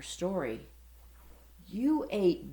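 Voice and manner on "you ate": stuttering. Acted. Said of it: "stuttered one".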